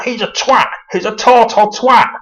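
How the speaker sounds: high-pitched imitation of angry ranting; mock angry. Two Northerners slagging him off, hammed-up anger. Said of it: "they're just really, really angry".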